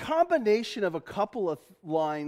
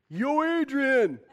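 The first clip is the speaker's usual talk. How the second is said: drawn-out, higher boxer's call; Rocky; 5 semitones higher, 4 dB louder, much slower than usual. Acted. Preacher imitating a film boxer calling Adrian, drawn-out shout. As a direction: holler it slowly like the movie boxer. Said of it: "you know, Rocky going".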